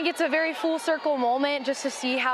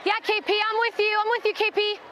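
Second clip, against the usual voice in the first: cheering shout; 7 semitones higher than usual. Real, not acted. Player cheering pitcher on field.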